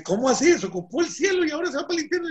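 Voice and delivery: very high, rapid astonished protest; incredulous. Retold or imitated. San Pedro to the departing paisa, incredulous. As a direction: blurt it out in high-pitched disbelief.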